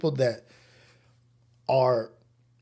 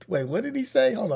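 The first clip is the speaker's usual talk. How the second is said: high, startled wait what; surprised; 10 semitones higher than usual. Real, not acted. Reading a viewer comment, high startled surprise.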